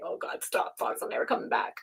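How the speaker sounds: a whispered mock plea; imagined hushed reply. Reader imagines Kelly's embarrassed plea, whispered. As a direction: breathe it like an embarrassed girl begging her to stop. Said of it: "Girls probably saying".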